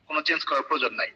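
normal talking voice

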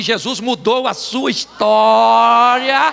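slow, swelling pulpit proclamation; preaching climax. Real, not acted. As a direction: stretch the last word out loud and long.

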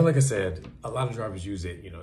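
usual speaking voice

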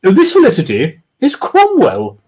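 lightly falsetto Jemima; woman's voice. Acted. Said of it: "replied Jemima Kingston".

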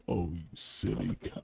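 low character voice. Acted. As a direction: growl the line in character. Repeated in low far voice.

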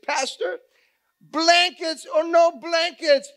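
high, loud, drawn-out declaration; slow, shouted defiance; uncontainable outburst. Retold or imitated. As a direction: burst out at the pastor, unable to hold back. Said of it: "couldn't handle it anymore".

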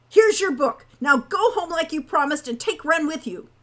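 loud, high angry command; angry order. A narrator's reading. Hawk ordering Owl home with Wren, angry and loud. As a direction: lay down the law at full volume.

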